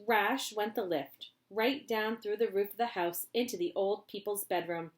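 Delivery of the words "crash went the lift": plain reading voice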